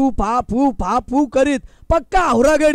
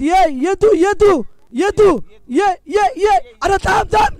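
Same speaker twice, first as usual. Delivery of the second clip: calling out; 4 semitones higher than usual. Real, not acted. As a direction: shout him back to the crease. Calling batsman back to crease.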